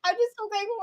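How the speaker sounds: high-pitched, blubbering retelling of tears; hammed-up tearful wreck, pitched high; mock weepy babble. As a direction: ramble through put-on sobs. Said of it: "I was a wreck".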